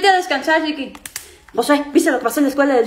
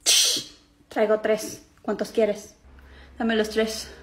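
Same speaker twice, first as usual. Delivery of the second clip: hushed dealing; 5 semitones lower, 6 dB quieter, much slower than usual. Acted. Venta secreta de chocolates.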